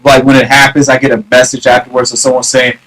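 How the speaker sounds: ordinary delivery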